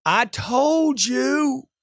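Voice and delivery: high, very drawn-out mock protest; hammed-up upset buyer; emotional customer outburst. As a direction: stretch it into an exaggerated wail of complaint. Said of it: "Why is he getting all emotional?".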